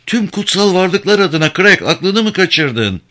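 high-pitched, disbelieving exclamation; incredulous outburst. A narrator's reading. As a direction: protest as if he has lost his mind. Stunned woman challenges his mad plan.

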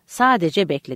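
plain reading voice

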